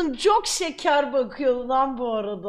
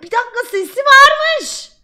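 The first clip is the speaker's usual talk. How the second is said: high, loud sudden surprise; surprised; 13 semitones higher, 12 dB louder than usual. Real, not acted. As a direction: blurt it out in shock. Discovers the clip has sound.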